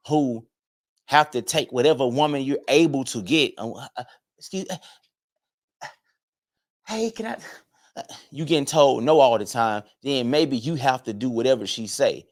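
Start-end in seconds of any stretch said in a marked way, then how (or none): 4.4-7.4 timid guy asking. Retold or imitated.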